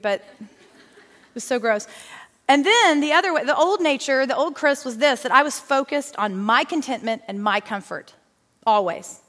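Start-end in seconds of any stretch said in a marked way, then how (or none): none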